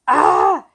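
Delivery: sharp high yelp of dismay; loud frustrated cry. Real, not acted. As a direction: let out a loud startled ah. Realizes the card fails.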